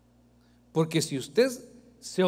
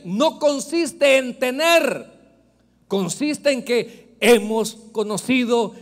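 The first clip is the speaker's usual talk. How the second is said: loud, slow, higher preaching; full-force preaching; 5 semitones higher, 10 dB louder than usual. Real, not acted. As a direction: build up to the crowd, slowly.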